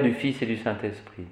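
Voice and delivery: ordinary talk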